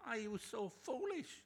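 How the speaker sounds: high, loud, slow disbelief; incredulous rebuke. Shown as a demonstration. Paul demanding of the Galatians, high and loud. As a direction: stretch out foolish in outrage.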